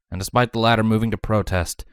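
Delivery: plain reading voice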